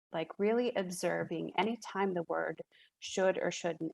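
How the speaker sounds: ordinary delivery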